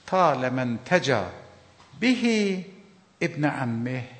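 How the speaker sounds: slow, deliberate reading of "طال من تجا", each word drawn out; slow Arabic recitation. Shown as a demonstration. Preacher reciting the Arabic hadith to the congregation, slow and deliberate. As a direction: recite "طال من تجا به ابن عمه" slowly and carefully, as a quoted text. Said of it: "عربیشو بگم و ترجمه کنم براتون".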